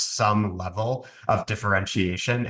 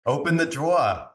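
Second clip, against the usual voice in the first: raised, hammed-up Long Island accent, drawer as DRAW-uh; 6 semitones higher than usual. Shown as a demonstration. Host demonstrates the word they had to unlearn, Long Island accent. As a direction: put on thick Long Island, dropping the R. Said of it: "pronounce with a Long Island accent".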